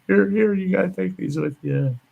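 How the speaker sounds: high-pitched voice of a woman rushing out; flustered gal. Retold or imitated. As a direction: imitate her calling out in a high voice. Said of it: "comes running out with the discharge papers".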